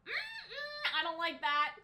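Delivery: ordinary talk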